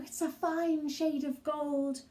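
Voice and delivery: usual speaking voice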